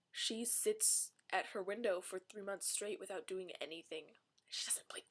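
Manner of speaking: hushed, mock-solemn whisper; whispered mockery of moping Bella. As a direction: whisper it like a sad secret.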